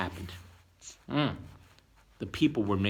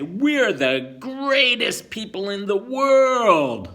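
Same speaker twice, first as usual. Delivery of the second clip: louder, higher and slow, boastful crowd; proud builders; 8 semitones higher, 9 dB louder, slower than usual. Acted. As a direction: boast grandly about the tower. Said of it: "They said".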